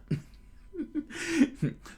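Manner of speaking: breaking into giggles; high burst of laughter. Real, not acted. Laughs at own story.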